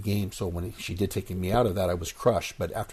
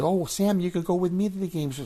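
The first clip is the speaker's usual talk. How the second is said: Cindy's invitation; 9 semitones higher than usual. Retold or imitated.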